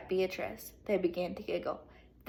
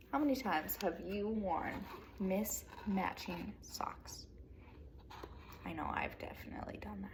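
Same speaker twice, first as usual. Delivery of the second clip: soft confiding whisper; whispered aside; 2 semitones lower, 11 dB quieter than usual. Real, not acted. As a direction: lean in and share a secret.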